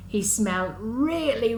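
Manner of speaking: plain reading voice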